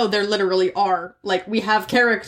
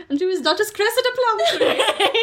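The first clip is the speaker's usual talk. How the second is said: laughing; 11 semitones higher than usual. Pretend. Retelling roleplay, laughing.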